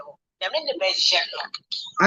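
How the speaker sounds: hushed, breathy aside; whispered quote. Retold or imitated. Retelling a bedroom quarrel.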